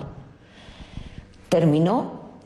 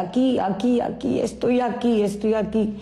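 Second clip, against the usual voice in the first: retold distant cries; 4 semitones higher than usual. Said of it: "se oía de gritos de fondo".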